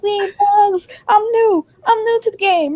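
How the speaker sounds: high-pitched retold protest. Newcomer to Tasha on being thugs, protesting. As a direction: plead innocence in a high voice.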